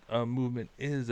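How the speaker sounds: normal talking voice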